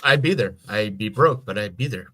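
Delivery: normal talking voice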